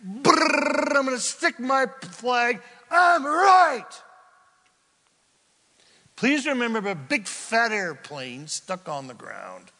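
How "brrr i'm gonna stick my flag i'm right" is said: high, rising to a loud shout; stubborn shout. Acted. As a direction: bluster and plant the flag. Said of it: "you are pretty convinced".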